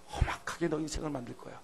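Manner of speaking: soft, hushed threat; whispered warning. Acted. Preacher voicing God's warning in a whisper.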